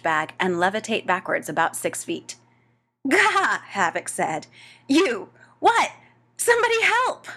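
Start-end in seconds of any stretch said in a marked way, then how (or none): 3.1-3.6 high, loud yelp of alarm; sudden high-pitched cry of horror; startled yelp. A narrator's reading. Havoc panics at Ed's tears. 4.9-7.3 high, loud yelps of alarm; frantic, high-pitched shouting; panicked cry. A narrator's reading. Havoc panics, calls for help.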